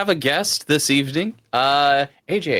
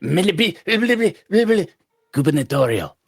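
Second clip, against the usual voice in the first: higher stuttering dazed mumble; dazed stammer; 8 semitones higher than usual. Acted. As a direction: stumble through the word, half conscious. Dazed character stammers a word.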